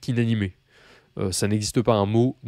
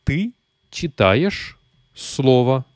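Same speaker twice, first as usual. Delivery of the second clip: Ты читаешь слово spoken very slowly, word by word; slow model sentence; 3 semitones higher, much slower than usual. Shown as a demonstration. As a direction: read it out slowly for the learner. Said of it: "tu lis un mot". (lentement, mot par mot)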